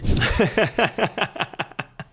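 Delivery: high staccato cackle; laughing fit. Real, not acted. Therapist cracking up at the caller's story, high helpless laugh.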